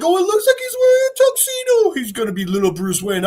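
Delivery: very high gushing voice; doting puppy talk. Retold or imitated. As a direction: coo over the dog like a baby. Peacemaker baby-talking the dog.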